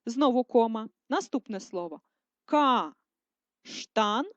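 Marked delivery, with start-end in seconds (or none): 2.4-4.3 каштан stretched into syllables; slow syllable dictation. Shown as a demonstration. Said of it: "Ділимо для переносу".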